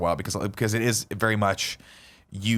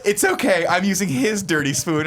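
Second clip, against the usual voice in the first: laughing quote; 6 semitones higher than usual. Retold or imitated. Retelling, laughing.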